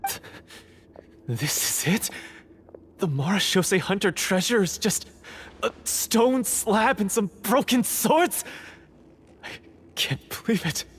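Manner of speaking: rising from shocked to a high cry of denial; a treasure hunter refusing to accept the slab; stunned disbelief. Acted. Hunter sees the worthless treasure, shocked disbelief building to a cry. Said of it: "suffer a serious mental breakdown".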